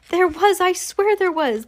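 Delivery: high-pitched insistent protest; shouting back. A narrator's reading. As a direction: insist in a high, indignant cry. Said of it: "the man was shouting back".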